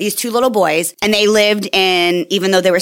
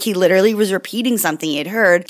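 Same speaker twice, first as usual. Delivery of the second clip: usual speaking voice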